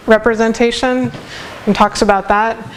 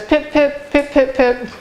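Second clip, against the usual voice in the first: 1 bird call imitation; 4 semitones higher than usual. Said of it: "he's saying, it says".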